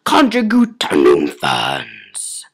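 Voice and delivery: drawn-out, very low, loud growl; barked alien command. A narrator's reading. Tree lady ordering the Jadoon, low drawn-out bark. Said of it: "barking at them".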